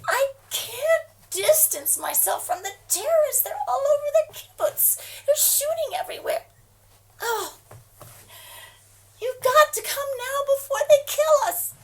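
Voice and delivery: high, loud sobbing plea; crying shout. Acted. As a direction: scream through tears.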